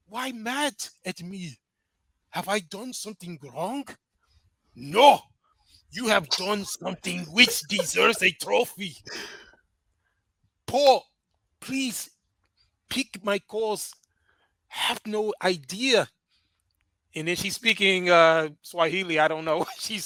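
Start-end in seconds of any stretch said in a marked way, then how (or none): 0.1-3.9 put-on hammy African accent, hurt and quiet; mock African accent, hurt; bad African accent. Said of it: "I gotta do it like this". 4.8-16.1 slow, drawn-out mock African accent; exaggerated African accent, pleading; hammy African accent for dramatic effect; bad African accent. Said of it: "I gotta do it like this".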